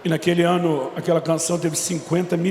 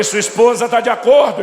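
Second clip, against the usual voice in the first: loud pointed question; 5 semitones higher, 7 dB louder than usual. Retold or imitated. Father challenging son about wife.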